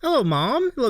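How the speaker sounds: high, gushing hello; a beaming sing-song welcome; delighted greeting. Real, not acted. Host greeting the guest's mom in chat, high delighted hello.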